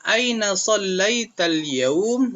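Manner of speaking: slow, high Arabic model reading; teacher reading to pupils, slow and high; slow model sentence. Shown as a demonstration. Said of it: "Hari ini kamu sholat di mana?".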